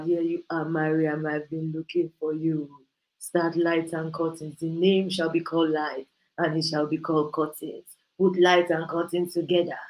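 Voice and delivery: solemn voice of God. Retold or imitated. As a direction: intone it like an oracle from heaven. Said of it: "He doesn't have to speak like that".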